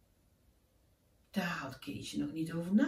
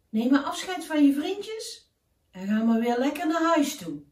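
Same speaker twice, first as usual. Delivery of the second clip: higher, louder calling raven voice; urgent raven; 7 semitones higher, 8 dB louder than usual. Acted. Raven tells Keesje the dream is ending, higher and louder. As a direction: call the boy back before he wakes.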